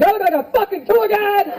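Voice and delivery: high mock snarl of a rude local; gruff New Yorker. Stranger snapping at a lost tourist, mock gruff. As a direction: snap it like a grumpy local. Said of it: "He's like".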